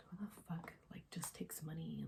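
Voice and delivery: whispered inner disbelief; muttered disbelief. Retold or imitated. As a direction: mutter it under your breath.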